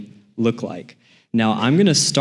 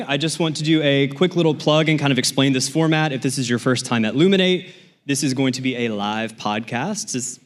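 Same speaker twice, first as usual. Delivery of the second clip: usual speaking voice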